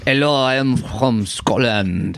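slow, badly faked Scottish accent; mock a foreign actor's attempt at a Scottish accent; hammed-up, unconvincing Scottish accent; Christopher Lambert. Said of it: "the flawless Scottish accent delivered by Christopher Lambert".

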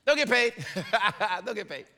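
a hammed-up carefree shrug; mock carefree. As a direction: toss it off like nothing matters. Mocking carefree attitude.

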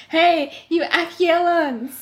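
high, slow call across the field. A narrator's reading. Laurent calls out to the squadron from the wagon. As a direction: hail the soldiers from the wagon.

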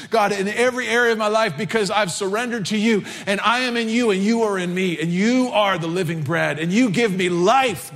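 loud, high impassioned preaching; preaching at full force. Acted. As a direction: pour it out like a fervent altar call. Preaching climax, impassioned prayer.

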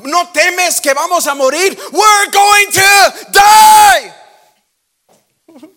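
high, loud, slow drawn-out scream of fear; terrified cry. Acted. Disciples screaming at the Lord in the storm, terrified. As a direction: wail it out like you're drowning. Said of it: "Y les dice".

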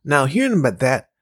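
ordinary talk

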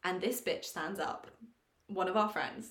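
ordinary talk

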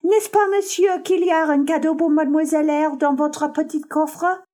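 put-on high, loud little girl pleading in French; a young French girl calling out eagerly to her guardian; excited child crying out. A narrator's reading. The child interrupts her master, eager and high-pitched. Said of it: "cried out".

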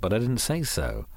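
plain reading voice

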